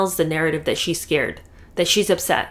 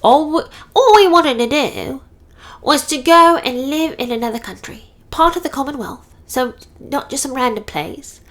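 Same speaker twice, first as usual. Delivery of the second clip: higher-pitched mocking impression of Harry; 7 semitones higher than usual. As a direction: parody him as a pleading prince, pitched up. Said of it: "'Cause he says".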